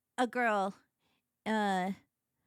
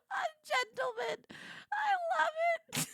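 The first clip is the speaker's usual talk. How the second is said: delighted squeal. Real, not acted. Squealing at the character.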